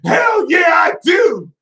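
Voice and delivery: high, loud, proud shout; defiant high shout; defiant yell. Pretend. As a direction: throw it back in their faces.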